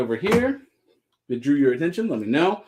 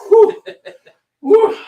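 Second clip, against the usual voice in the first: high laughing whew; 11 dB louder than usual. Real, not acted. Laughing exhale after a joke.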